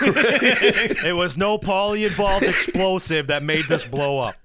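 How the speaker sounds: high, slow sing-song through laughter; mock the cover story while laughing; giggly singsong parody of an official; laughing mock statement.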